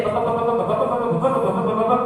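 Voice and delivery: rapid nagging repetition; lightly voiced nagging neighbour.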